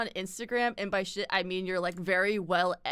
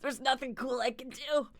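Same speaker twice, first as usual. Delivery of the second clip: higher, whiny grumble; mock complaining; 5 semitones higher than usual. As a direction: whine hammily about being stuck. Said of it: "Meanwhile, I'm just like".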